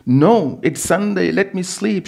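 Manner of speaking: put-on a small girl begging to sleep on; sleepy child. Seven-year-old daughter refusing to wake, sleepy whine. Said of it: "she said, rolling over".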